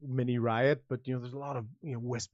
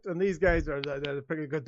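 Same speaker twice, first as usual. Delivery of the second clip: ordinary talk, nothing marked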